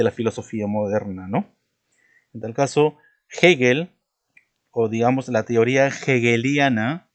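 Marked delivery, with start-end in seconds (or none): 3.4-3.9 "Hegel" said as "Jegel", with a Spanish J sound at the start and a raised, louder voice. Shown as a demonstration. Teacher showing students that "Hegel" starts with a J sound. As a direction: sound the H in "Hegel" like a Spanish jota, pressed out clearly. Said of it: "fíjate cómo se pronuncia".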